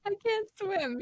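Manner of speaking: high squeaky I can't swim; mock high wail; imitating Johnny. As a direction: echo the boy's wail up high. Echoing Johnny's line.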